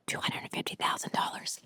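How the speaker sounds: hushed whisper about the cash. Retold or imitated. Telling friends about the cash bag, whispered. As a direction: whisper it like a secret.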